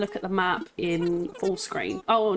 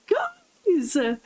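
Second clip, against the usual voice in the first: ear-splitting excitement; 9 semitones higher, much slower than usual. Real, not acted. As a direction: shout it to the viewers in delight. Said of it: "I hope I didn't blow your eardrums off".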